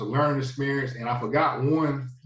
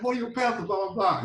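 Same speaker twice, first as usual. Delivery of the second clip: higher, excited family shouting the news; 10 semitones higher than usual. Retold or imitated. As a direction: voice the brother bursting with excitement.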